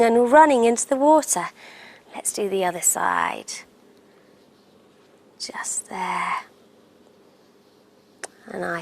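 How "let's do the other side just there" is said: soft whisper. Real, not acted. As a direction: whisper it close. Quiet painting aside.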